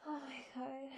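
ordinary talk, nothing marked

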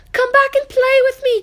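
very high, loud call after the rabbits; calling after them. Acted. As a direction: call them back as they vanish. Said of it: "called the little rabbit".

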